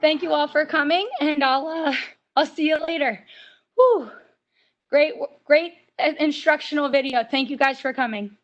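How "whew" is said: loud blown-out breath of relief; relieved whew. Real, not acted. Instructor after the workout, relieved loud exhale. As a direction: blow it out, glad it's over.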